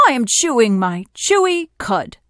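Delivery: mildly sulky storybook camel. A narrator's reading. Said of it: "said the sulky camel".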